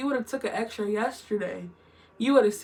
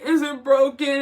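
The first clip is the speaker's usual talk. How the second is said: loud panicked question; 4 semitones higher, 8 dB louder than usual. Retold or imitated. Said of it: "I was like".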